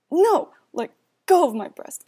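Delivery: loud desperate cry; shouting. Acted. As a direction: yell it out in panic. Fighting off attacker.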